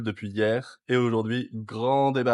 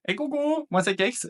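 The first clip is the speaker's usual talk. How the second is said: chirpy hello; 11 semitones higher than usual. Real, not acted. High cheerful greeting.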